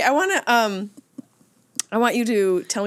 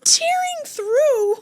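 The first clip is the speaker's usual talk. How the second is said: high squealed laugh, slow; crying with laughter; much slower than usual. Real, not acted. Laughing at a friend's fart, squeaking with laughter.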